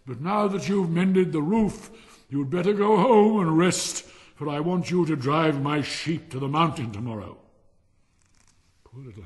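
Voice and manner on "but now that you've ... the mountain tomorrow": dispense orders loudly and grandly; bossy miller. Acted. Miller sends Hans home before the next job, loud and bossy.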